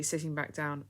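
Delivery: ordinary delivery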